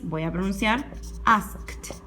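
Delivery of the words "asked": asked drawn out to "ask-t"; slow asked with -ed as a lone T; final T sound. Shown as a demonstration. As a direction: release the T after the K in asked. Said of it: "Como termina en K en su raíz".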